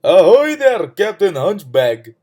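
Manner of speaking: loud, drawn-out call; shouted hail. A narrator's reading. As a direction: hail him heartily from above. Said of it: "the fool shouted".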